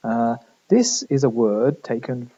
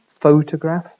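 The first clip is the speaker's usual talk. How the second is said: "photograph" as FOH-tuh-graf, first syllable strong; stress on PHO; 3 semitones higher, 5 dB louder than usual. Shown as a demonstration. Lecturer explaining where main stress falls, first-syllable stress on "photograph". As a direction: lean on the first vowel of "photograph". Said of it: "main stress is on the first vowel".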